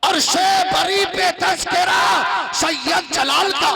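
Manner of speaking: loud, high poetic recitation; recited couplet. Acted. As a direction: declaim the verse to the crowd.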